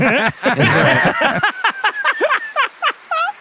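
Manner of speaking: very high squealing giggle; squeaky laugh. Real, not acted. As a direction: let out a shrill squeaky laugh. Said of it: "the man with the high-pitched laugh".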